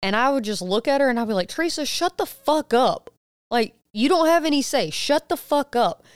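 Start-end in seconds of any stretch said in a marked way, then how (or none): 1.5-6.0 high, sharp mock yell at Teresa; snapping at her to shut up; angry retold outburst. Mother retelling yelling at Teresa to shut up, angry.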